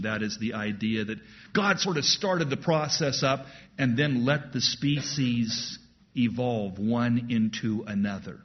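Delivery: normal talking voice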